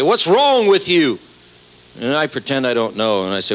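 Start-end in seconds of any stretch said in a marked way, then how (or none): 0.0-1.2 high, slow indignant demand; mock angry. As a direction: drag it out like an offended fan. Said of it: "Then they get real mad".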